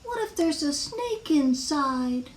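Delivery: high, slow fretting; little worrier imagining the worst; anxious worrying. Acted. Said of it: "Wemberly worried".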